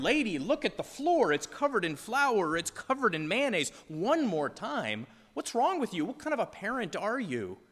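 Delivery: mock-indignant bystander; exasperated outburst. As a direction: onlooker scolding the lax mother, exasperated. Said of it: "And we think".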